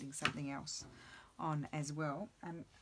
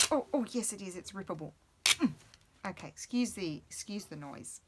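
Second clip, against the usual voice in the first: normal talking voice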